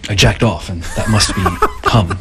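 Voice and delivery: breaking into laughter while talking; laughing. Real, not acted. Telling a girl his roommate's confession, laughing. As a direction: barely get the punchline out for laughing.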